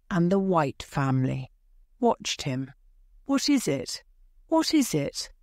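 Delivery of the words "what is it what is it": high-pitched anxious questioning; urgent cry. A narrator's reading.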